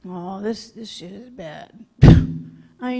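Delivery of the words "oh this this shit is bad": much softer, low under-the-breath dread; a lower, far softer aside of dread; hushed dread. Retold or imitated. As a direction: say it low so nobody hears. Seeing the locked girls.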